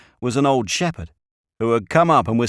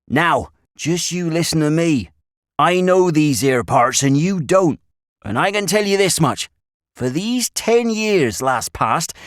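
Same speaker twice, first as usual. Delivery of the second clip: loud, higher rustic lecture; earnest country shepherd; 7 semitones higher, 4 dB louder than usual. A narrator's reading. Shepherd making the boys listen to him, earnest rustic warning. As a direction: press the warning in broad country speech.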